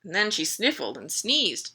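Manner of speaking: ordinary delivery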